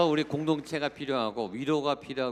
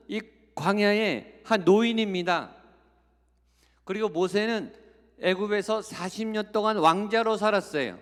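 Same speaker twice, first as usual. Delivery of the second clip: higher, driving sermon voice; impassioned preaching; 5 semitones higher than usual. Acted. Pastor preaches about Moses to the congregation, fervent and insistent. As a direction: press the point home from the pulpit.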